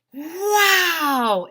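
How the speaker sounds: high, loud drawn-out wow; amazed wow. A narrator's reading. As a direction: gasp it out amazed. Everyone sees Mister Will.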